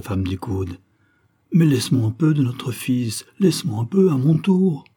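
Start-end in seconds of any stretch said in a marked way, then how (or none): 1.5-4.8 put-on emotional old father begging, higher at the end; moved, insistent plea; tearful pleading father. A narrator's reading. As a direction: plead for a turn, pitch climbing. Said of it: "qui pleurait aussi".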